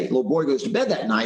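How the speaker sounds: usual speaking voice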